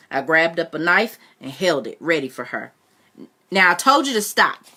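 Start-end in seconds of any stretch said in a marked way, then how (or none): 3.5-4.6 loud desperate cry; boy screaming at his aunt; screamed. Acted. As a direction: yell it to make her back off. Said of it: "I screamed".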